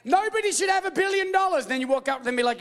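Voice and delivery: normal talking voice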